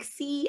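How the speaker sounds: a small high "See." squeaked out; timid "See." said far too quiet; anxious "See."; nervous "see". Retold or imitated. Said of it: "I would say "see".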